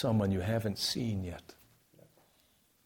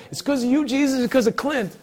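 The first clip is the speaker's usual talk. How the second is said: loud, high mock thanks; mock gushing gratitude; 13 semitones higher, 8 dB louder than usual. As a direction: imitate a gushing believer, voice pitched up. Acts out misguided thanks in heaven.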